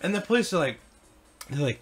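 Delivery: normal talking voice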